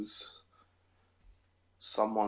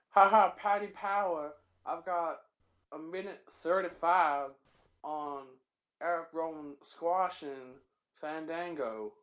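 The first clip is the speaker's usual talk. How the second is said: higher, drawn-out Southern commentator; JBL impression; 5 semitones higher, slower than usual. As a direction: boast it in a slow mock drawl. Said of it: "It sounded more like him".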